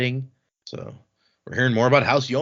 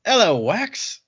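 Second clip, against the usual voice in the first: lightly imitated Cockney greeting, pitched up; Cockney accent; 7 semitones higher, slower than usual. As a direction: greet him with a playful Cockney lilt. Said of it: "his accent definitely sounds like Cockney".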